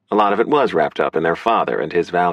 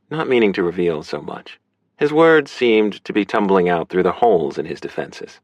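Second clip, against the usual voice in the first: plain reading voice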